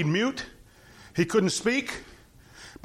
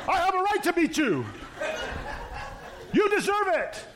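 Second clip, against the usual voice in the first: high, put-on self-righteous voice; indignant Balaam; 9 semitones higher than usual. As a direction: huff like a man defending himself. Balaam justifying himself to donkey.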